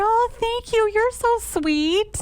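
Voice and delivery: a high, drawn-out sugary thanks; sweet chirpy reply. Retold or imitated. Quoting her own sweet reply.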